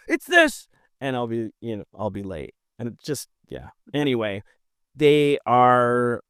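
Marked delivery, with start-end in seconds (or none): 0.1-0.7 sudden eureka. Pretend. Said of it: "and I'll go".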